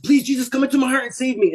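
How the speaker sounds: fast, high-pitched retold prayer; desperate plea. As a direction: beg to be saved in a high rush. Retelling his desperate last prayer.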